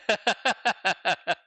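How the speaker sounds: bursting, high-pitched giggle; high laugh. Real, not acted. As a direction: crack up loudly at the email. Amused reading student's email.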